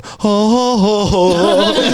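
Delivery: high, loud mock moans of oh; hammed-up moaning oh oh. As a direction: ham up a pleasure moan.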